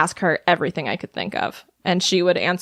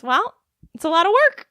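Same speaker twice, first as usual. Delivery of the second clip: much higher mock-mom voice; imitating mom's answer; 11 semitones higher than usual. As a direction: quote mom's answer in a raised pitch. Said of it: "And her answer was".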